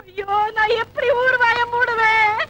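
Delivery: slow, drawn-out tearful wail; wailing lament. Acted. Woman fearing the town's gossip, wailing. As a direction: cry it out in despair.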